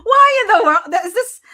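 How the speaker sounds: high, loud laughing disbelief; surprised. Real, not acted. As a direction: blurt it out in shock. Prank voice interrupts the deposition.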